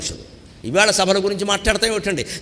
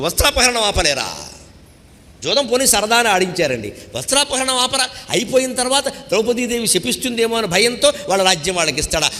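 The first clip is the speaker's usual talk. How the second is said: ordinary delivery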